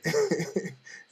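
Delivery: short, loud chuckle; amused laugh. Real, not acted. Speaker amused by own casual request to God, chuckling.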